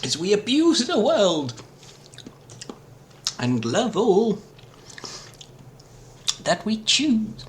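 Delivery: high, silly put-on voice. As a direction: pitch it way up like a cartoon. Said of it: "Silly voice man does the voice".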